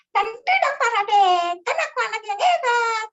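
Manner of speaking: put-on very high, loud little-child voice; Binti. Shown as a demonstration. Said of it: "karakter suara kecil".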